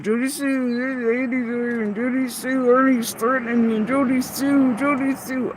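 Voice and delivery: imitating gossipers calling Jody Sue; mocking nagging voice. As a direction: whine the name over and over like a snitch. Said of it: "over here going".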